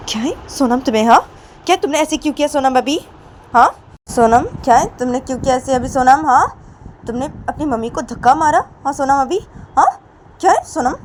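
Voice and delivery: high, loud scolding with sharp questions; acted outrage, voice jumping up; angry Poonam. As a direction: tell the child off indignantly. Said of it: "Sonam के ऊपर गुस्सा हो जाती है".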